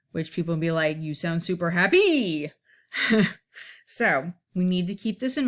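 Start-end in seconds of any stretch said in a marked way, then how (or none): 1.0-2.5 HAPPY stressed on its last syllable, hap-PY swooping up; wrong stress. Shown as a demonstration. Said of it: "if we did it the other way".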